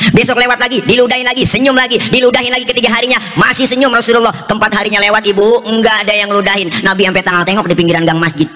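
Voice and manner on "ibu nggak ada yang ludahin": ordinary talk, nothing marked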